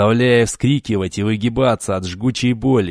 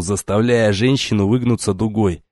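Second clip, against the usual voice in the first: usual speaking voice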